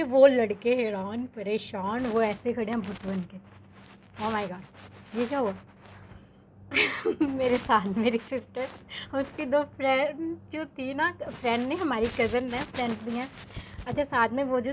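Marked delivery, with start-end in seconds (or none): none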